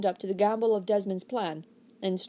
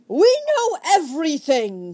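loud, slow booming declaration; thundering; 8 semitones higher, 8 dB louder, slower than usual. Acted. Desmond cutting off Sir Philip's protest, thundering. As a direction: thunder it down on him. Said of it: "thundered Desmond".

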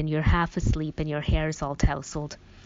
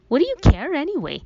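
higher, fast defiant challenge; angry demand; 10 semitones higher, 3 dB louder, faster than usual. A narrator's reading. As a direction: hurl it at him, defensive. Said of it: "she demanded".

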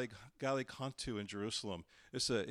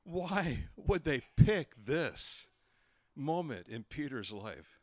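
loud, very slow, stretched-out question; incredulous; 3 semitones higher, 5 dB louder, slower than usual. Real, not acted. Preacher questioning the sculptors' choice, loud and incredulous. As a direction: spell out the disbelief word by word, loudly. Marvelling at the statue's chosen moment.

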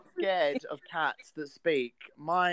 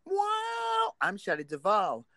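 cat as Shelley; 13 semitones higher, 4 dB louder than usual. Retold or imitated. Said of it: "which I translate into".

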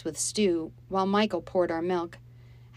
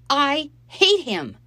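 high, loud, slow hateful cry; furious outburst; 9 semitones higher, 10 dB louder, slower than usual. Acted. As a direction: spit it out in fury. Molly furious at Dave.

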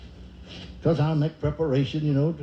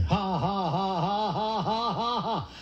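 forced fake laugh; 3 semitones higher, 4 dB quieter, slower than usual. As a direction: put on a slow, evenly spaced fake laugh. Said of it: "I immediately started laughing again".

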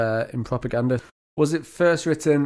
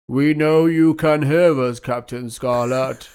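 slow, louder menacing alien villain; drawn-out robotic threat from the Mysterons; 4 dB louder, much slower than usual. Retold or imitated. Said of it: "the Mist ones when they used to be like".

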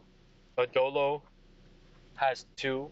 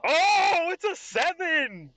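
high, loud drawn-out reaction to a roll; excited outcry; 7 dB louder, much slower than usual. Real, not acted. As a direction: cry out the result, voice soaring. Game master reacts to die roll.

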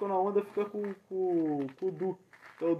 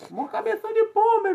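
mock taunt; 13 semitones higher, 4 dB louder than usual. Playful insult performance.

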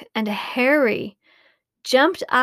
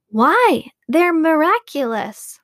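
higher, louder burst of wonder; amazed monkeys; 5 semitones higher, 5 dB louder than usual. A narrator's reading. Little monkeys marvel at the magnifying glasses, amazed. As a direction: gasp it out in delight.

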